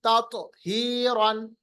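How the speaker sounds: ततहीरन with rounded lips, bouncing त; rounded-lip qalqalah. Shown as a demonstration. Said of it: "होठों को गोल कीजिए". (गोल होंठों से ततहीरन)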